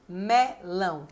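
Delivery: melão slowly split, me-lão; syllable by syllable. Shown as a demonstration. Teacher dictating the first rhyme word, melão slowly by syllables. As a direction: separate the two syllables of melão with a small gap.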